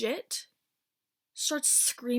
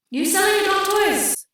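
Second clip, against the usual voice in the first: imitated high, very loud yell; screaming; 9 semitones higher, 14 dB louder than usual. Delivery man confronting the host, screaming. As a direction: holler the question at full volume. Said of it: "like, just screaming".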